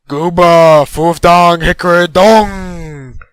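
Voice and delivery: loud, groaning boom, drawn out at the end. A narrator's reading. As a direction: boom it out like a being above. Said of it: "A voice boomed back from somewhere above him".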